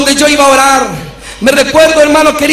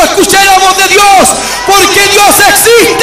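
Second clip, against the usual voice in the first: retold shouting; 5 semitones higher than usual. Running into the street proclaiming God, retold shouting. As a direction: cry it out at a raised pitch, stretching the ending. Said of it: "salí gritando hacia afuera".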